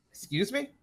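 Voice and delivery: high-pitched startled query; taken aback. Acted. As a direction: turn round with an affronted, rising question. Startled character turns on swinging player.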